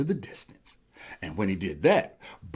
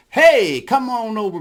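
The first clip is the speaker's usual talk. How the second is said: loud, higher hail; calling out; 5 semitones higher, 6 dB louder than usual. Acted. Elephant spotting rabbit in these parts, calling out.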